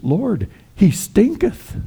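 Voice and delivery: high, slow, hammed-up objection; stark protest. Martha warns Jesus in King James words, stark protest. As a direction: draw it out high in mock dismay. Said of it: "It's more stark".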